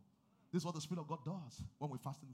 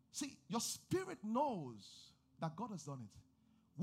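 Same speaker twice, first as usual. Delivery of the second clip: slow emphasis; 5 semitones higher, much slower than usual. Acted.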